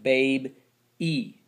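"Babe E", split before a separate ee; long E ending. Shown as a demonstration. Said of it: "That Y makes the E sound".

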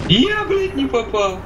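high squeal of annoyance; startled high outburst; frustrated yelp. Real, not acted. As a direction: cry out at the miss. Missed shot, frustrated.

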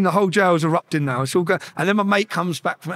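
ordinary talk

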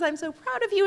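high-pitched, imitated weepy pride; tearful proud mother. Mother hugs her novelist daughter, tearfully proud. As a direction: act a mother welling up. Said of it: "my mother starts to cry".

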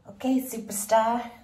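loud, slow hype at her reflection; hyped self-cheer. Real, not acted. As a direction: crown yourself a superstar, slowly. Admiring herself in outfit.

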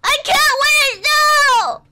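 high desperate yell; panicked shout. Real, not acted. Screaming in panic.

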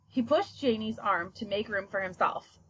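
ordinary delivery, nothing marked

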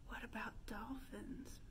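ordinary talk

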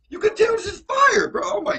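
hyped. Real, not acted. Hyping the track to viewers.

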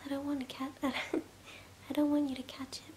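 ordinary delivery

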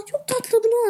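high gasping squeal of delight. Real, not acted. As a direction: gasp and coo over something adorable. Gasps at a cute item.

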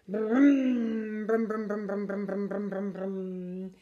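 the bus pulls off and fades; bus engine noise. Retold or imitated.

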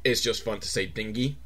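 ordinary delivery

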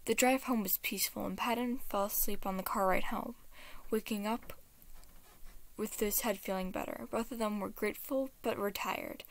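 usual speaking voice

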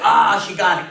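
ordinary talk, nothing marked

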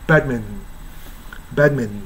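"バドミントン" as bad-m'n, tail swallowed; American reduced "バドミントン"; only first syllable audible. Shown as a demonstration. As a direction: stress bad and let the rest of "バドミントン" vanish. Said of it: "まるでバーしか聞こえない".

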